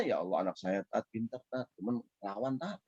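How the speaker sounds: quiet, grieving parent complaining to the teacher; a hushed, sorrowful sigh of a hurt parent; sad parent lament. Retold or imitated. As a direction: softly lament a child who talks back. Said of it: "sedih orang tuanya".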